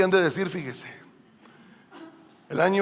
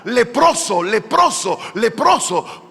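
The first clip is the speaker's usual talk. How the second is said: imitating a leper calling out, a touch raised; acted leper's warning call, higher and firm; mock warning cry; 4 semitones higher, 4 dB louder, slower than usual. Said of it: "tuviera que gritar".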